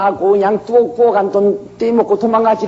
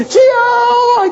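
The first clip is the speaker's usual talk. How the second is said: long drawn, high, loud wailing cry; a very high, very slow crying shout of 주여; 4 dB louder, much slower than usual. Acted. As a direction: wail it out to the Lord, stretched long. Pastor cries out to God.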